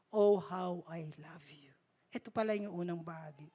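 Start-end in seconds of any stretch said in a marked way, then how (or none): 0.1-1.7 a hushed, voiceless repeat of the lyric; whispered lyric. Retold or imitated. Preacher whispers God's love song to the congregation. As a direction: breathe the love line in a whisper.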